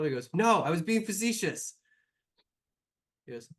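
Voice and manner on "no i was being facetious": exasperated. Acted. NPC exasperated reply.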